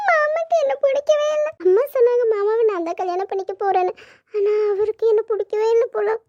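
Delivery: crying, pitch climbing into a wail; heartbroken, high tearful complaint; tearful heartbreak. Acted. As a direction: lament through tears, voice rising. Heartbroken that uncle rejects her.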